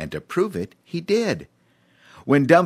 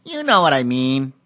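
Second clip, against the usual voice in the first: high brushing-off chimp; chiming chimp; 6 semitones higher, 5 dB louder than usual. A narrator's reading. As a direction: wave it away, chimp-like. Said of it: "chimed Cheemy".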